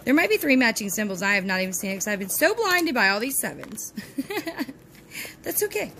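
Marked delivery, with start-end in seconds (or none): 4.7-5.9 breathy laugh through words; laughing it off softly. Real, not acted. Laughing at own bad luck.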